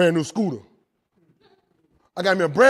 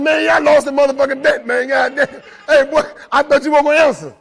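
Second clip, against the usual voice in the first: louder, higher, laughing gloat; triumphant; 7 semitones higher, 9 dB louder than usual. Real, not acted. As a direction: crow over winning the bet. Triumphant over won bet.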